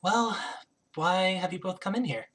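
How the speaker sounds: soft, lightly voiced queen; queen character. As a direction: enquire quietly as a composed queen. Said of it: "queen looks at you and goes".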